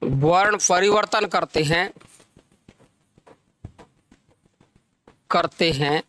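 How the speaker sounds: measured teacher dictation with long pauses. Real, not acted. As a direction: dictate phrase by phrase, leaving time to write. Dictates the definition to students.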